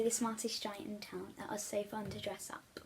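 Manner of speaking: hushed aside to the viewers; whisper. Real, not acted. Reader whispering an aside to her viewers. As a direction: whisper it like a little secret.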